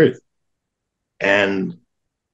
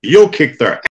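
higher, louder, gleeful answer; happy brother; 9 semitones higher, 7 dB louder than usual. Retold or imitated. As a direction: blurt it out delighted, up high. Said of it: "he gets all happy and he goes".

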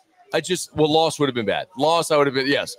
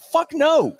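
much higher, louder mock retort; retold emphatic no; 12 semitones higher, 3 dB louder than usual. Answering an interviewer's question, a loud high no. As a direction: fire back a high, loud no. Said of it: "I was like".

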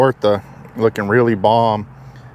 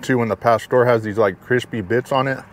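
chewing a bite. Real, not acted. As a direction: mouth partly full. Seen 1: fingers put food in mouth; napkin only at 3.0.

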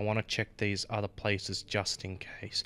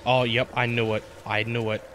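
being chased; 7 dB louder than usual. Real, not acted. Monster appears, chase begins.

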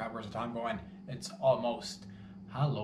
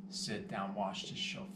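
normal talking voice

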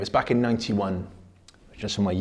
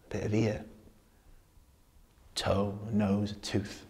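soldier reminiscing. Acted. Soldier telling lads of a lost friend, subdued.

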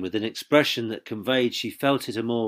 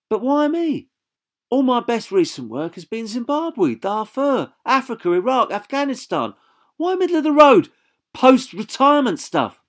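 much higher and louder, indignant; indignant protest; 14 semitones higher, 6 dB louder than usual. Acted. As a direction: refuse the safe job, exasperated. Jane protesting, rising indignation.

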